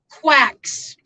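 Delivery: "quacks" set apart and stressed firmly; absolute emphasis. Real, not acted. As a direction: hit "quacks" as a verdict on its own. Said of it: "I say that with absolute emphasis".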